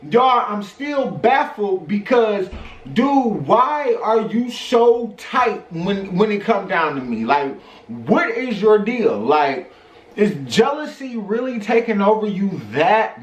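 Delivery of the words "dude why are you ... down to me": usual speaking voice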